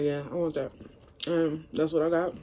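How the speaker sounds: ordinary delivery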